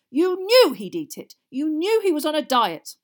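loud, high, angry charge; roaring accusation. A narrator's reading. Father accuses his son over the prank, roaring. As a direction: keep roaring, hammer the accusation. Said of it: "roared Mister Weasley".

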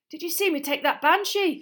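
high, loud schoolboy boast; breaking out in eager shouting; excited shout. A narrator's reading. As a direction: crow about it to your mates. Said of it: "shouted Seamus".

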